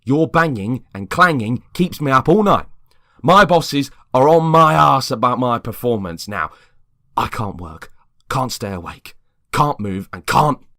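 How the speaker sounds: loud, high, building rant. Acted. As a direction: build the anger line by line. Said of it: "voice getting louder".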